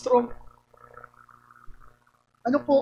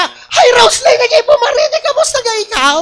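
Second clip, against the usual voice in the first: very high, loud greeting with laughter; loud Batangas voice; 12 semitones higher, 7 dB louder than usual. Shown as a demonstration. Showing how Batangas people talk, high and loud. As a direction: shout it high like a Batangas local. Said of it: "Mataas ang boses".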